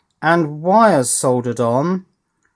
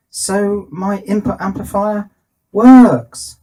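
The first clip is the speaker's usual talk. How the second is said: higher pitch, bursting loud on the last word; triumphant announcement; 7 semitones higher, 7 dB louder, slower than usual. Real, not acted. Engineer announcing the amplifier works after testing, triumphant. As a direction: proudly declare it, punching the final word.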